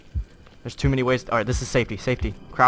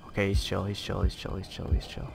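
quiet self-soothing; 5 semitones lower, 8 dB quieter than usual. Real, not acted. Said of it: "calming himself down".

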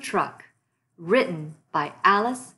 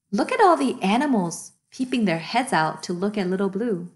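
ordinary delivery, nothing marked

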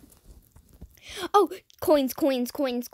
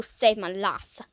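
normal talking voice

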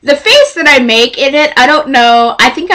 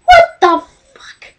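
shocked yelp; 4 dB louder than usual. Retold or imitated. Startled by a hard pinch.

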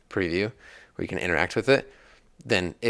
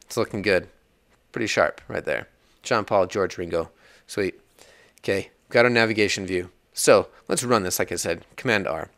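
ordinary talk

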